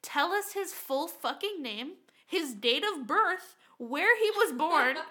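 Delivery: usual speaking voice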